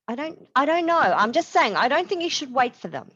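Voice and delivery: high, stammering evasion; flustered denial. A narrator's reading. Roberts evading Marisol's question, flustered and high. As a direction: dodge the question nervously.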